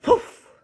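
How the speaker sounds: sudden loud puff of air; forceful blown whoof. Acted. Reader imitates the BFG blowing the trumpet, forceful whoof. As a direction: blow out a sharp, loud whoof.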